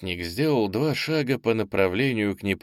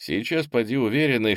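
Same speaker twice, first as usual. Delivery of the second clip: ordinary delivery, nothing marked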